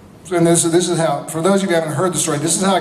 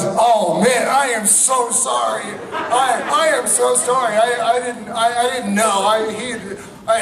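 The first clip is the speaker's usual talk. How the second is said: higher, stammering mortified apology; flustered apology; 6 semitones higher, slower than usual. Retold or imitated. Producer apologises to the husband he offended: higher flustered apology. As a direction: trip over the words, horrified at the gaffe. Producer realises he insulted wife.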